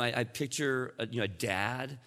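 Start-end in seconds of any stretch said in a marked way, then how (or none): none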